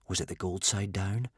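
plain reading voice

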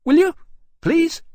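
very high, loud little dragon pleading; chirping little dragon. A narrator's reading. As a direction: chirp a short, eager plea as a small dragon. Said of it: "chirped one of the Winchesters suddenly".

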